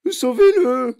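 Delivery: high, loud plea for help; panicked mother's holler; horrified yell. A narrator's reading. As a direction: scream out in panic for rescue. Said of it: "hurla madame Gloop en blêmissant".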